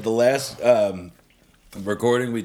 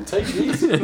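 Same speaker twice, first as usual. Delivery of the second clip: laughing; 12 semitones higher than usual. Real, not acted. Laughing teasing after fall.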